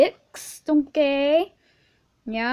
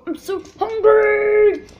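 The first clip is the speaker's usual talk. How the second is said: slow, high, loud wail of hunger; hungry holler; 7 semitones higher, 6 dB louder, slower than usual. Real, not acted. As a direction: yell it, holding the last word long. Comic outburst about hunger.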